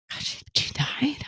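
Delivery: stunned whisper, rising question; breathy whispered question as the news lands; hushed shock. Retold or imitated. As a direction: whisper it in disbelief. Boyfriend reacts to news of death.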